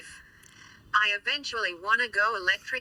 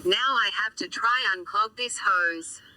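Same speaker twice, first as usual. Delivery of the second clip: robot voice. Real, not acted. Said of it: "you're gonna have to put up with my robot voice for a bit".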